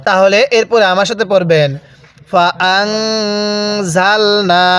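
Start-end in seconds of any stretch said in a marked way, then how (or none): 2.3-4.7 ফাআনজালনা very slow with a held nasal hum on the n; nasal ghunnah. Shown as a demonstration. Teacher demonstrates ghunnah on ফাআনজালনা, very slow. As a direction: hold the নুন with ghunnah through the nose, slowly. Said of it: "হ্যাঁ, কিভাবে পড়ব?".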